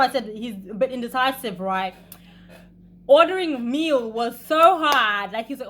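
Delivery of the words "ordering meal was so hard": exasperated. Real, not acted. Exasperated emphasis.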